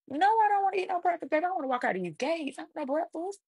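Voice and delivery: high-pitched, incredulous refusal of breakfast; indignant refusal. Retold or imitated. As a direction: turn the offer down in a high, scoffing voice. Mock refusal of offered breakfast.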